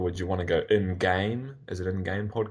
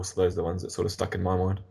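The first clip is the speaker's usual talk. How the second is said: usual speaking voice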